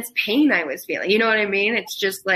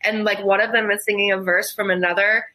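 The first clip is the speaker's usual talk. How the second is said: ordinary talk, nothing marked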